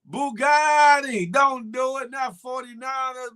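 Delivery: loud, drawn-out call of the name; loud pleading call, don't do it. Real, not acted. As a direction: shout it across the room, stretching Bugatti. Calling out to a caller.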